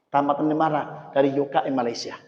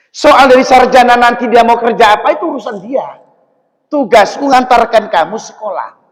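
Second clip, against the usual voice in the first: raised, insistent father; father's firm voice; 8 semitones higher, 14 dB louder than usual. Acted. Father telling his child the job is done. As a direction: push it as the father who only wants them graduated.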